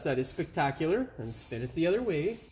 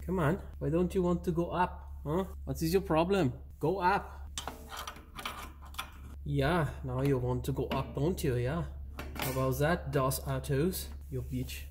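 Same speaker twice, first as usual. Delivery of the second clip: put-on German accent; 2 semitones lower, 4 dB quieter than usual. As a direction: coax and scold in a mock German accent. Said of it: "That's enough German accent there".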